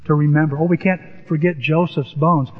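usual speaking voice

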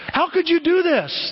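high-pitched, drawn-out press question; indignant reporter. Acted. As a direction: demand an answer like a reporter. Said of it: "like you've watched the press do".

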